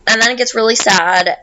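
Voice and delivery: usual speaking voice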